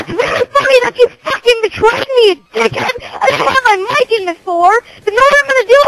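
high, loud raging yell over the mic; enraged gamer shrieking insults; furious screaming. Real, not acted. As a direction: scream it at the traitor with everything. Betrayed player screaming at teammate.

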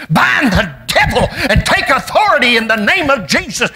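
high shouted sermon climax; preaching at full force. Acted. Preacher shouting to the church to stand up, full-force preaching. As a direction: whoop it up over the congregation.

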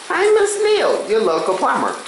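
squeaky toy plumber. Pretend. Toy plumber character speaks.

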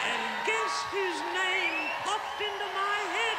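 excited buildup. Acted. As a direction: whip the crowd toward the reveal. Building up to big reveal.

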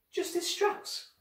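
high, soft, slow query; incredulous drawn-out question; startled disbelief. Acted. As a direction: echo the name in airy disbelief. Girl startled by the news.

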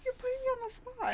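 high, quick, squirming complaint; embarrassed protest. Real, not acted. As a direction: protest in a high voice at being pushed. Pressed to perform French, protests.

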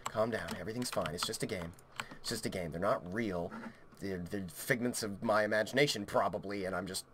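very low, hushed voice to yourself; soft, low, trying to calm down; self-soothing murmur. Real, not acted. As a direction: talk yourself down under your breath. Soothing himself after a scare.

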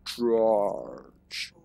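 low, drawn-out growl of "charge"; half-hearted monster battle cry, stretched out; mock charging scream. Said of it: "screaming whenever he charges, he goes".

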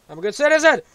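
loud, high, desperate insistence; shouting. Real, not acted. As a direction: yell it at full force. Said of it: "I'm just shouting".

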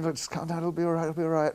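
lightly voiced calming; calming him. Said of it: "And I'm going".

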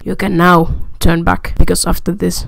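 loud hammed-up doom warning; mock ominous narrator. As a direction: boom out a fake dire warning.

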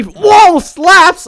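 high, loud burst of delight; excited whoa. Real, not acted. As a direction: yelp at the slap. Seeing someone get slapped.

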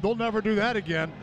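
ordinary delivery

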